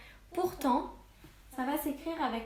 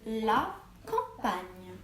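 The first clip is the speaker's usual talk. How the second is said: la campagne said slowly, syllable by syllable; slow corrected word; much slower than usual. Shown as a demonstration. Teacher slowly reading back the corrected word. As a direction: spell it out slowly in your voice.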